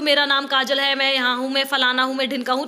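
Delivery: low monotone, one clause into the next; run-on without pauses. Shown as a demonstration. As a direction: rattle off the introduction with no stops. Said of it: "हमने कहीं पर विराम नहीं लिया".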